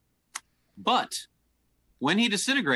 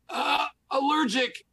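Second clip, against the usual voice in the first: much higher, slow gnome voice; quoting the gnome; 12 semitones higher than usual. Retold or imitated. Dungeon master quotes the dying gnome, high and slow. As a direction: squeak out the gnome's dying words slowly. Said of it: "He said".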